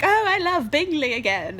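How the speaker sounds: a hint of a dreamy sister in love; mock swooning Jane. Jane swooning over Bingley's return, lightly mocked. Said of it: "Jane's like".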